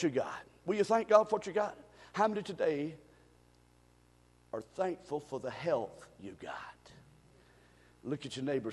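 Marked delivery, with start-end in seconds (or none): 4.5-8.7 whispered, very low question; soft pulpit plea; hushed appeal. Real, not acted. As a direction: drop to a whisper to make them lean in. Preacher drops to a whisper.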